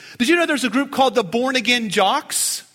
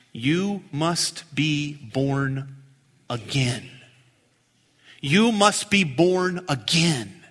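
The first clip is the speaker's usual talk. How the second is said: slow solemn declaration; 8 semitones lower, 3 dB quieter, much slower than usual. Retold or imitated. Jesus' answer, slow and grave.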